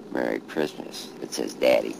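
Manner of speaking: normal talking voice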